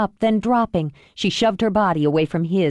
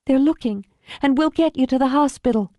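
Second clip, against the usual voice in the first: higher-pitched, anxious reassurance; urgent daughter; 6 semitones higher than usual. Acted. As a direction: urge your wounded father to hold on.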